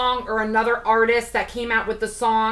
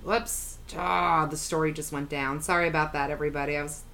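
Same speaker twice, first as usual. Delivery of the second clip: ordinary talk, nothing marked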